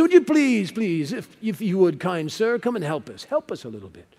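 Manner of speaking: hammed-up pleading in a terrible English accent; goofy English accent. Preacher replaying the Macedonian man's plea, goofy English accent. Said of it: "goofy, terrible English accent".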